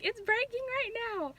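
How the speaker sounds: high, alarmed retold cry; panicked. Retelling her water breaking.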